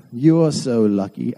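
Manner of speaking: usual speaking voice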